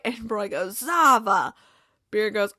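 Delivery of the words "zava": drawn-out "Zava" with a falling tune; lightly acted, weighty announcement; retold coach's answer. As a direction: imitate an emphatic one-word reply. Voices coach's repeated one-word answer.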